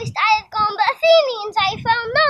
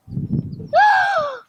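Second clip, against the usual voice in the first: startled gasp; 12 semitones higher than usual. Acted. Lysander wakes with a start.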